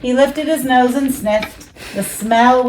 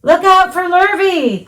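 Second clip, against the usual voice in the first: higher cows calling out; 9 semitones higher, 3 dB louder than usual. A narrator's reading. Cows warning Wilbur, calling higher. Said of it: "called the cows".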